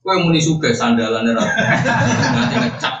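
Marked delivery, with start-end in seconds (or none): 1.4-2.9 drawn out through laughter, pitch up; laughing. Real, not acted. Preacher cracking up at his own joke, laughing. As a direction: laugh the words out slowly.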